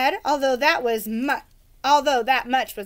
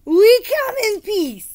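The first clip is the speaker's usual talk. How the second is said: higher, louder, slow heroic knight voice; gallant knight; 9 semitones higher, 8 dB louder, slower than usual. Acted. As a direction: proclaim peace like a grand knight. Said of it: "Sir Fartsalot assured them".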